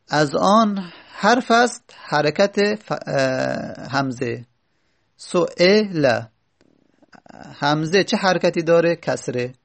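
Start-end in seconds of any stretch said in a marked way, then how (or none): 5.2-6.2 سئل drawn out slowly; slow demo of su'ila. Shown as a demonstration. Teacher repeating the example slowly, drawn out. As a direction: stretch سئل out for the students. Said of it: "بین مخرج الحرف".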